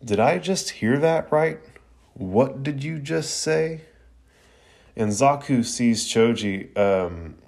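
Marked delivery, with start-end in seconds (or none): 0.0-3.8 pitched-up, slow ominous ask; ominous question. Retold or imitated. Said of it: "he asks".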